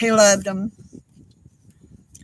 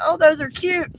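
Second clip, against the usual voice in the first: delighted; 7 semitones higher, 5 dB louder than usual. Retold or imitated. Retelling her delighted reaction.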